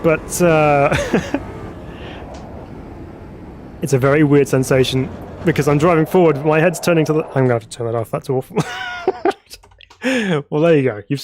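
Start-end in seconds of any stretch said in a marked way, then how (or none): 1.0-3.8 high, breathless wheezing laugh; helpless laugh. Real, not acted. Streamer cracks up as the camera head keeps turning. 8.6-9.5 a quick high burst of laughter; short laugh. Real, not acted. Streamer laughs after deciding to turn off the awful effect.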